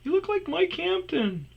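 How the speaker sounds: very high comic falsetto; mock a stranger's recognition in falsetto; sustained, squeaky-high impersonation; imitated gawking stranger. Imitates strangers approaching at gym.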